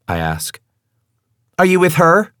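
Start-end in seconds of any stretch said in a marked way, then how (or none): 1.6-2.3 high, loud, sharp counter-question; accusing. Acted. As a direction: fire it back at him. Female character snapping back.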